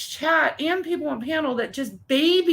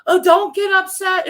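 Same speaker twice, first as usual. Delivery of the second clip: higher, louder mock-soothing plea; coddling; 6 semitones higher, 7 dB louder than usual. Chat members cater to upset lady, mock coddling. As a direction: imitate people fussing over her.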